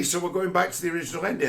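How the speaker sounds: ordinary talk, nothing marked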